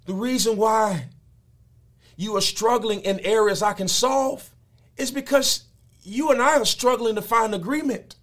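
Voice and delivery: high, loud, fervent preaching; preaching at full force. Acted. Pastor thundering God's words at the congregation. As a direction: drive it home like a revival sermon.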